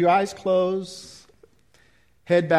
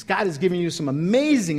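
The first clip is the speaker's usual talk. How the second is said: usual speaking voice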